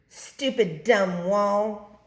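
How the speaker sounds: slow, loud, cross snap; snapping. A narrator's reading. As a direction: spit it at the fallen wall. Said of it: "Snap Bean snapped".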